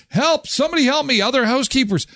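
high, fast, pleading cry for help; mock screaming for help. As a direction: plead with the other housekeepers, hammed up. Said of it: "you can hear them screaming".

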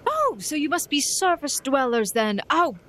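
doctor realizing who they are; surprised doctor voice. Acted.

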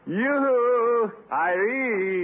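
high, loud sing-song yoo-hoo; calling out. Acted. Gildersleeve calling to Irene across the schoolyard, high loud call. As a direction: hail her from across the yard.

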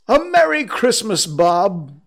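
high, loud, joyful greeting; hearty earnestness. Acted. Scrooge greets his clerk, heartily earnest. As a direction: wish it with full-hearted earnestness. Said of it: "said Scrooge with an earnestness that could not be mistaken".

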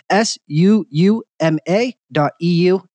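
normal talking voice

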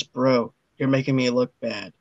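low, hushed word to a brother; confidential reproach. Retold or imitated. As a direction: lean on him quietly, voice dropped. Acted talk in interrogation room.

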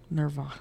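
"Nevada" slurred toward [name], ner-VAH-duh; slurred mispronunciation. Real, not acted. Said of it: "I'm thinking of [name]".

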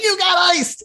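Bobby Pin pops up to taunt Rooster, high and gleeful. Retold or imitated. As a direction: crow it like a prank landed.

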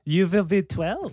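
slow, put-on German killer voice; mock German accent. Co-host joking as killer to next victim, slow mock German accent.

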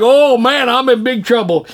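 loud, high mock dismay; comic panic. Man chasing the girl through the crowd, comic panic. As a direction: wail it in hammed-up worry.

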